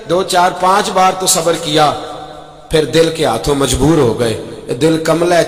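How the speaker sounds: ordinary talk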